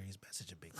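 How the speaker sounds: hushed aside, breath only; whisper. Real, not acted. Telling Jamal about a fan's message, in a whisper. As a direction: whisper it so the fan can't hear.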